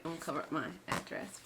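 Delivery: normal talking voice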